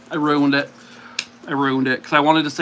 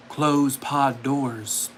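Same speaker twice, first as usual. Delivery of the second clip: slow quote; 3 semitones lower, much slower than usual. Acted. Giving movie quote clue slowly.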